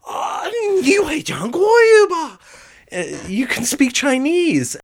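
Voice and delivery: eyes wide with astonishment; astonished shout. Retold or imitated. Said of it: "their eyes would get wide".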